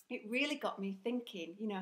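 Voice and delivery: ordinary talk, nothing marked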